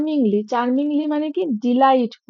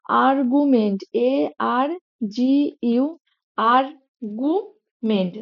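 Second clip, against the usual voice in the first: Argument drawn out slowly, syllable by syllable; split into syllables; much slower than usual. Shown as a demonstration. Teacher breaks the word into syllables for spelling. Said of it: "syllable ভেঙে ভেঙে করি".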